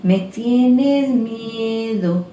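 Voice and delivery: slow singsong mocking taunt; sarcastic ghost girl. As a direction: croon it like a teasing ghost child. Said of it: "la niña más sarcástica". (niña sarcástica canturreando lento)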